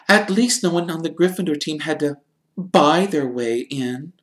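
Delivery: put-on high, crisp girl's retort; lightly voiced sharp girl; sharp Hermione. A narrator's reading. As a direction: cut him down sharply. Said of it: "said Hermione sharply".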